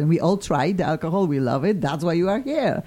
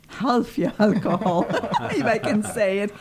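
laughing; 6 semitones higher than usual. Real, not acted. Host laughing at own joke.

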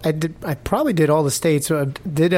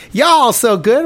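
higher-pitched mock Southern greeting; imitated Southern accent; 7 semitones higher, 3 dB louder, much slower than usual. New Yorker imitating the next state's locals, Southern accent. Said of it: "And then the next state".

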